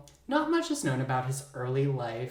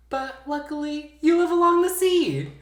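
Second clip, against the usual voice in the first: sing-song uplift; 13 semitones higher, 7 dB louder than usual. Acted. Story narration turns upbeat.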